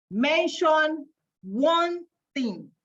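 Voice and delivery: slow, deliberate reveal of "Mention one thing"; very slowly. Shown as a demonstration. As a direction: stretch every word of "Mention one thing" like a drum roll. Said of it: "read it very slowly".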